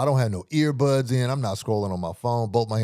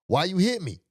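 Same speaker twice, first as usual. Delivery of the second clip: mimicking stranger; 8 semitones higher than usual. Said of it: "he's like".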